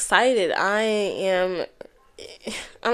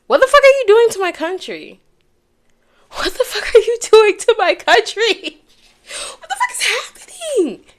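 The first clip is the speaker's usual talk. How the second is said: much higher and louder, furious disbelief; outraged yell; 12 semitones higher, 6 dB louder than usual. Real, not acted. Vlogger yells at those in power, outraged. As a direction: shout it at the people running the country. Outburst at the country.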